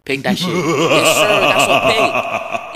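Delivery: loud, laughing cheer; laughing celebration. Real, not acted. Gamer celebrating his own interception, laughing cheer.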